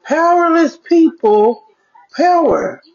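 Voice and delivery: "Powerless people" lifted high and slow, musing; pondering the quote. Retold or imitated. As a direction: turn "Powerless people power" over in your head, slowly. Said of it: "I'm like, hmm".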